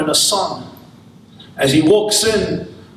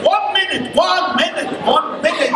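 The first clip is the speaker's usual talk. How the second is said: higher, shouted one minute to grab passersby; calling out; 10 semitones higher than usual. Retold or imitated. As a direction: stop travelers mid-stride. Chabad volunteers calling out to travelers.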